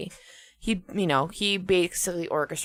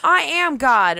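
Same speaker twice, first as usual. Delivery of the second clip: high, loud grandiose proclamation; megalomaniac rant; 9 semitones higher, 4 dB louder than usual. Retold or imitated. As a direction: proclaim yourself a god. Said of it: "And he's like".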